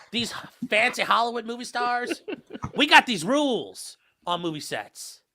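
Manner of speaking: hammed-up big city New York lawyer, lightly done; a mock New York attorney lecturing country folk, not quite landing; bad New York accent. Mock New York lawyer sweet-talking a New Mexico jury, hammed-up accent. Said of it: "Worst New York accent ever".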